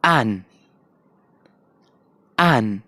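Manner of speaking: एन in a British accent; British English an. Shown as a demonstration. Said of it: "British में".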